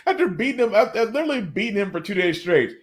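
usual speaking voice

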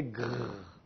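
"grrr" as a soft back-of-throat rasp; rubbed g sound. Shown as a demonstration. Teacher shows students how the air rubs through the throat. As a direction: let air scrape through on "grrr" like a French r. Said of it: "je laisse passer l'air un peu".

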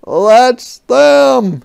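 loud, high shout of recognition; army shout. Acted. Soldiers spotting the culprits, shouting. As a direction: shout it out on sighting them. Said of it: "shouted the army".

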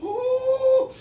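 high, loud cry of dismay; hammed-up distressed "oh"; upset wail. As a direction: wail like a poor, overwhelmed lad. Jack's upset reaction to roaring.

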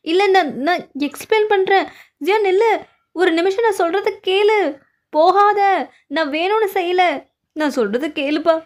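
loud, high, frantic begging; desperate pleading. Acted. Begging Jiyan not to leave, high frantic pleading. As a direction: shout after her so she won't walk away.

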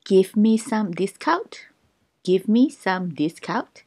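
very slow, each syllable clear; slow teaching pace; slow drill. Shown as a demonstration. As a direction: spell it out slowly for learners. Slow drill repeated twice.

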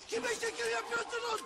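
shrill threatening repeat. Acted. Tough guy repeats higher.

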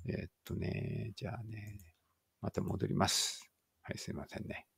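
ordinary delivery

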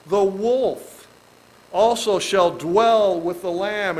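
slow, solemn reading of the verse; scripture reading. Acted. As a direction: read the verse slowly and solemnly.

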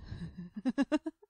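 loud, rising cackle; big acted laugh. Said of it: "Zone laughed loudly".

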